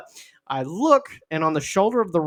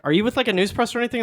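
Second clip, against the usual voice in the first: quick, high-pitched questioning; mock questioning; 8 semitones higher, much faster than usual. Someone questioning a passerby at the tree, mock questioning. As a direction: fire off a higher, hurried question.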